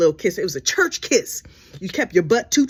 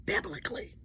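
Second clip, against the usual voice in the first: breathy hushed aside; whispered innuendo; 10 semitones higher, 11 dB quieter than usual. Real, not acted. Sly whisper of the euphemism to the audience. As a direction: drop it to a whisper.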